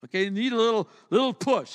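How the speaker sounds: usual speaking voice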